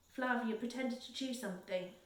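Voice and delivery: plain reading voice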